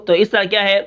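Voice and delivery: ordinary talk, nothing marked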